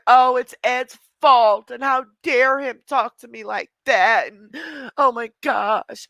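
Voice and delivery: hammed-up imitation of a crybaby; mock crying Crystal. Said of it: "Now she's gonna cry and".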